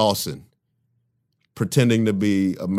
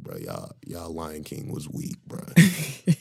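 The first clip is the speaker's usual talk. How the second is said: muttered; 5 semitones lower, 7 dB quieter, slower than usual. Retold or imitated. Retold muttered insult.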